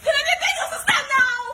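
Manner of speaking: high, loud frantic call with a laughing sob; frantic shout. Acted. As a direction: yell for her to come now.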